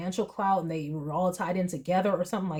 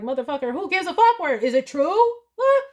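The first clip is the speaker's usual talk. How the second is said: higher, louder and fast, fed-up swearing; indignant outburst; 8 semitones higher, 5 dB louder than usual. Retold or imitated. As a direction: blurt it out, outraged at the judge. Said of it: "I was like".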